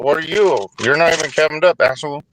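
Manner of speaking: usual speaking voice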